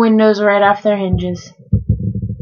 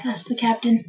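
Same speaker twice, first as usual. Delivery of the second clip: usual speaking voice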